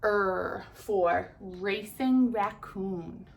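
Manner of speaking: er drawn out slowly before racing raccoon; slow, stretched letter sound. Shown as a demonstration. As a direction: say er slowly and clearly. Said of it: "and R says".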